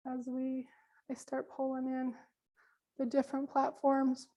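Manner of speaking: hoarse, fading voice; losing voice. Real, not acted. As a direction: voice giving out. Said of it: "I'm losing my voice".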